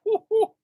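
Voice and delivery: very high "whoo, whoo"; spontaneous high whoop. Real, not acted. Preacher marvelling at his changed talk, whooping. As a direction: let out two quick high whoops. Exclaims mid-testimony.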